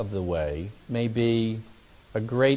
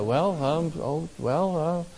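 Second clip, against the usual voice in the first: halting, softer mumbling as if deflated; flustered hesitation; 3 semitones higher, 6 dB quieter, slower than usual. Acted. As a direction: act mildly taken aback, searching for words. Angry person caught off guard, acted.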